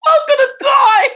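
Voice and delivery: hammed-up terrified wail, very high and loud; panicked scream. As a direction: shriek it like the end has come. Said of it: "That's how I felt".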